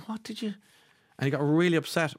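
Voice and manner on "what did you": high, softer imitation of disbelief; mock bewilderment. Actor hearing another man's voice in his role, mock bewilderment. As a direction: squeak out a baffled half-question.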